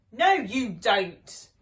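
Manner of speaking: loud, slow stern yell. Acted. Neighbours bursting in on the robbers, loud and slow. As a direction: catch them in the act. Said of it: "yelled their neighbours".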